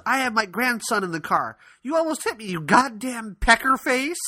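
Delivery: high mock tirade, slowing on the final insult; indignant grandmother. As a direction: imitate a furious grandma cussing someone out. Grandmother cussing out rude driver.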